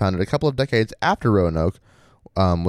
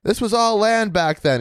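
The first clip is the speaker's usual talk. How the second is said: shouting over machinery; 11 semitones higher than usual. Retold or imitated. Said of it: "he shouted over the engine".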